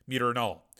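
usual speaking voice

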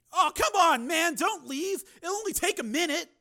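a nosy fan barging in; pushy fan. Acted. Bar patron interrupting Steele, pushy.

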